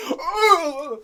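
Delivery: high, loud strained groan; exaggerated pained "ugh"; imitated grunt. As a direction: groan as if shoved hard. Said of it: "He was like".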